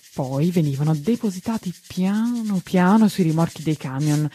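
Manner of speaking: ordinary delivery, nothing marked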